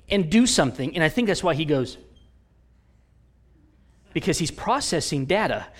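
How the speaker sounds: ordinary talk, nothing marked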